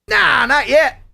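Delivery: high, loud mock no; playful high-pitched denial; teasing refusal. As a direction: tease them with a big loud nah. Teasing refusal to play the video.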